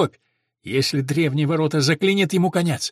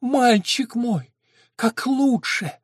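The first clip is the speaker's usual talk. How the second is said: high, slow motherly lament; pained and pleading, pitched up; moaning; 7 semitones higher, much slower than usual. A narrator's reading. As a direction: stretch the words into a high moan. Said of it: "простонала она".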